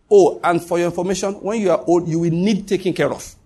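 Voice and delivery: ordinary talk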